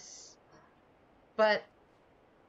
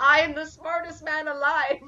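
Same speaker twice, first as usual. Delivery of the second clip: higher, louder triumphant movie quote; Billy Madison; 5 semitones higher, 6 dB louder than usual. Retold or imitated. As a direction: shout it like a proud fool. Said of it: "It reminds me of Billy Madison".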